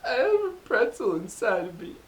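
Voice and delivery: high, whiny, near-crying cartoon voice; a frantic little candy on the verge of tears; wailing cartoon pretzel voice; neurotic pretzel M&M. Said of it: "Where he's like".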